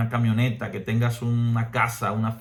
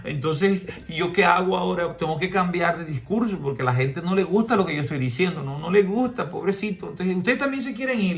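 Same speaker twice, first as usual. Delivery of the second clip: high, whining mock lament; mock a preacher pleading for approval, much higher; whiny self-pitying Jesus; 6 semitones higher than usual. Preacher mocks a whiny Jesus who fears losing followers, high whimper. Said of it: "Jesús no se pone a llorar".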